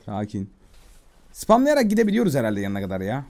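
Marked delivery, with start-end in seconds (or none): 0.1-0.5 soft, low calming voice; soothing. Pretend. Player calming a spooked horse, soft and soothing. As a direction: gently settle a nervous animal.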